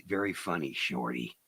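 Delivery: very low, soft deadpan; low deadpan Eastwood put-down; Clint Eastwood. Retold or imitated. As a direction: sink deep and quiet, dry as dust. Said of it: "'cause his voice".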